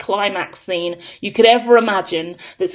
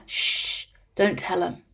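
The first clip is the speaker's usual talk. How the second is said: playful hush; 3 semitones lower, 10 dB quieter than usual. Pretend. Secret shared with viewers.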